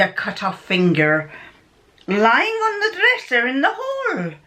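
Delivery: put-on amateur Scottish accent straying into Irish; terrible Scottish accent. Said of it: "My Scottish accent's terrible".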